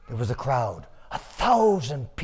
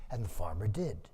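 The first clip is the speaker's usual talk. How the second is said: plain reading voice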